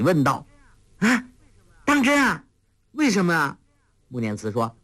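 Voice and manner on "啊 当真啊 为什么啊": thrilled, pitch shooting much higher; delighted. Acted. Huang Rong overjoyed, asking Mu Nianci why. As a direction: burst out in eager joy. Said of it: "黄蓉大喜".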